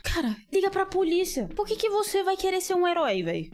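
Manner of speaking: high, urgent plea at the screen; exasperated plea. Real, not acted. Yelling at game character.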